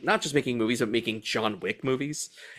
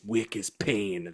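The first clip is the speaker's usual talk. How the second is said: quiet mantra; 5 semitones lower, 6 dB quieter, slower than usual. Retold or imitated. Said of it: "That's the mantra they say to each other".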